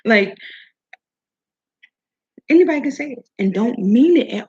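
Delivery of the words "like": normal talking voice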